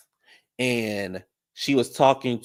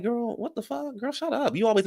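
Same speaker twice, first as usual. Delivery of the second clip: a much higher, softer sassy voice; high mock retort; 12 semitones higher, 4 dB quieter than usual. Viewer talking back at Evelyn in a high mock voice. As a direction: snap back in a high pitched-up voice. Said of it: "And I'm like".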